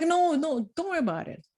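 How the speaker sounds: high-pitched imitated polite refusal; breezy insistence. Brushing off sister's offer of money, high breezy insistence. Acting out her usual reassurance.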